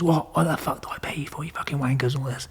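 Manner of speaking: high, sharp outburst that sinks to a hissing whisper; mock the furious dealer yelling down the phone; angry boss.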